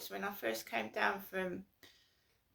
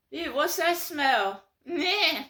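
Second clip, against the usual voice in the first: slow, loud, higher sniffy voice; posh disgust; 6 semitones higher, 7 dB louder, slower than usual. Retold or imitated. Posh mates mocking her, slow sniffy disgust. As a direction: wrinkle your nose like a snob. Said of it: "posh mates".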